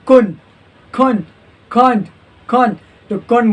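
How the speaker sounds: "कुन" as one syllable with no d or t; reduced COULDN'T. Shown as a demonstration. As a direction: end "खून" on the n. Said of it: "तो खून बोलना है".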